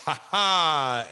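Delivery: drawn-out "ha ha" cackle; over-the-top, stretched stage laugh; showy character laugh. Shown as a demonstration. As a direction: laugh like a flamboyant wrestling showman. Demonstrating his old wrestling catchphrase laugh.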